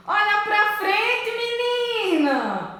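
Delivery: loud, high-pitched scolding call; alerting mother. Acted. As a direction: call out sharply to warn your child. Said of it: "tentava me alertar".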